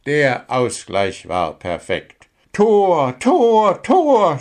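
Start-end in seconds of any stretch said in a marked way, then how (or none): 2.5-4.4 loud, high-pitched goal cheer; Gundula screaming. A narrator's reading. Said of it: "schrie Gundula".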